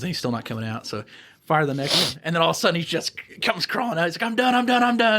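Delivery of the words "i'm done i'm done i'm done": high, repeated frantic giving up; mock surrender. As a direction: imitate a beaten man begging off, pitched up. Inmate crawling out, surrendering.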